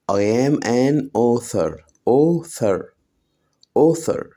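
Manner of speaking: slow model sentence. Shown as a demonstration. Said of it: "teacher models slowly".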